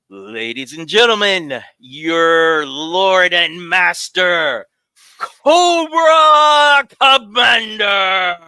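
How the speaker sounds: slow, loud, high grand announcement; announcer shout. Acted. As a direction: stretch out the villain's name like a ring announcer. Second try at guest intro.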